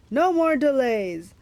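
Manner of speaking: loud, slow monster order; monster command. A narrator's reading. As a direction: lay down the law as the monster.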